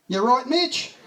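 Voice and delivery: high, loud, cheery mock call; casual call. Harrow calls to the half-drowned boy, high and cheery. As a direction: sing it out offhand.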